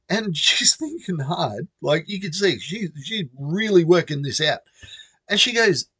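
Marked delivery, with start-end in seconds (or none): none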